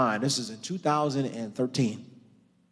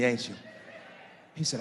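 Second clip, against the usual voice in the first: ordinary delivery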